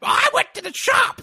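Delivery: high squawking parrot voice. Acted. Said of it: "what does caca sound like".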